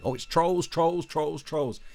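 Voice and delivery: high, whiny mock cry of "trolls"; mocking alarm. Parodying critics crying trolls, high and whiny. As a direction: ridicule the troll panic. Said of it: "That's like".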